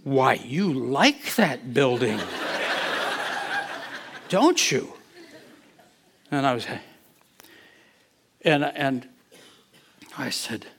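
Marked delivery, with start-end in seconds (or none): 0.0-4.9 slow, with a breathy laugh breaking in; almost laughing. Retold or imitated. Pastor on the floor teasing God about the building, slow and laughing. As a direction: tease God gently, laughter escaping. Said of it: "then I almost laughed".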